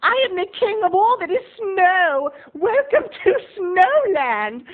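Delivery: hammed-up jolly mall king welcoming children; grand fake-cheerful announcement to the kids; Snow King greeting. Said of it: "what I had to walk around and say was".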